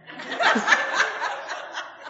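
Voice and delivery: high breathless laugh. Real, not acted. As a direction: break into a laugh. Laugh after mock complaint.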